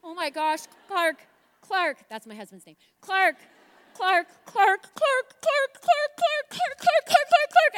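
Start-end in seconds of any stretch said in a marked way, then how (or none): 0.0-2.0 loud and higher, calling out a name. Shown as a demonstration. Calling her husband Clark from the balcony, loud and urgent. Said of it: "here's exactly what happened". 3.0-7.8 loud, much higher, frantic repeated calling; screaming his name. Retold or imitated. Screaming her husband's name in a panic from the balcony. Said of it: "screaming his name, uh, crying his name".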